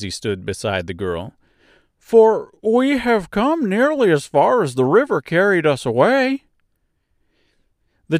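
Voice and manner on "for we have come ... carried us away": high-pitched, louder Scarecrow; Scarecrow character voice. Acted. Scarecrow remarks to the girl about the road, high character voice. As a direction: play the Scarecrow high and lively.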